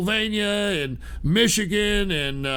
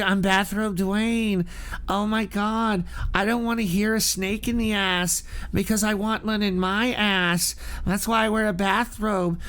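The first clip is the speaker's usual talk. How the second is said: mock influencer voice. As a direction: sneer through a limp influencer impression. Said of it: "every male influencer out here is like".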